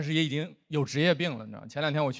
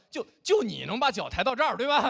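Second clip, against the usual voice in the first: pitched-up mock huffy challenge; mock indignant; 7 semitones higher than usual. Imitating offended spectator at a dance show, mildly indignant. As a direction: play suddenly losing patience with a show-off. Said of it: "就急眼了".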